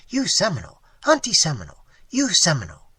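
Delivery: the Indian insisting to the waitress; insistent Tiger Tail. A narrator's reading. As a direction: hammer the point home.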